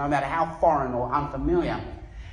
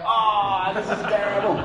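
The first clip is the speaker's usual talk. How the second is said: retold exasperation; 3 semitones higher than usual. Retold exasperation at spelling his name.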